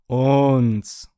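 sehr laut und lang gezogen; wie aus einem Lautsprecher. A narrator's reading. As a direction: das Wort als dröhnende Durchsage halten. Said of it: "wie aus einem Lautsprecher". (laut hallende Gerätedurchsage)

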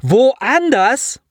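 high, outraged question; blustering disbelief. A narrator's reading. Commander hearing of misplaced cells, blustering in disbelief. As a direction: throw WOANDERS back as a question. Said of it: "pollte Gutes".

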